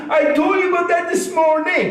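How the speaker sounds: high mock shout; wife yelling. Husband playing his wife yelling at him, high shout. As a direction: shriek the wife's complaint.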